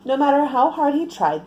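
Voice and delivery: usual speaking voice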